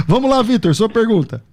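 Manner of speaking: high, lively host call; upbeat. Real, not acted. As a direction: cheerfully move things along.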